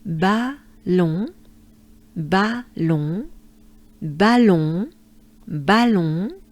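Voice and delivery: ballon with a nasal end and rising tune; French ballon. Shown as a demonstration. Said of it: "so far we have".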